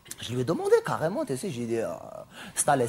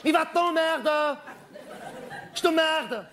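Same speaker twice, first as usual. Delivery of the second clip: mock insult; 10 semitones higher, slower than usual. Insulting someone in audience.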